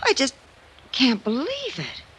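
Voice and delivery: giddy disbelief, asking permission; thrilled stammer; overwhelmed. Acted. Told she inherited necklace.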